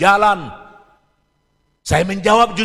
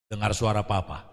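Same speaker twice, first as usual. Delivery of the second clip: much softer and lower restating; quiet recap; 8 semitones lower, 7 dB quieter than usual. Shown as a demonstration. Preacher recaps the father's words to congregation, quiet. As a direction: drop the voice to repeat the lesson. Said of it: "preacher repeats the instruction softly".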